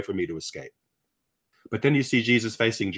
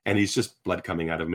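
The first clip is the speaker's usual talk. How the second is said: normal talking voice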